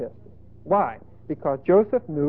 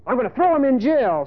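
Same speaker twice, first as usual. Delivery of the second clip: acted indignation, higher pitched; higher, heated mock outrage; steamed husband; 5 semitones higher, 3 dB louder than usual. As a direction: play a betrayed, fuming husband. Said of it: "And Potiphar is steamed".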